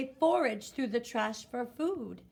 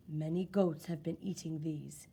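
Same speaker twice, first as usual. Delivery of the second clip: lower, grave butcher's voice; low-voiced butcher; 6 semitones lower, 4 dB quieter than usual. A narrator's reading. Butcher telling grandmother her goats have died, low and grave. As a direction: speak it low and serious, like a village butcher. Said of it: "the butcher is speaking in a low voice".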